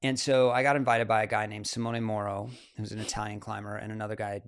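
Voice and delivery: usual speaking voice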